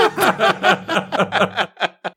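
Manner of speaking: long burst of real laughter; laughing. Real, not acted. Speaker cracks up at own joke about dying young, laughing. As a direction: laugh it out in a run.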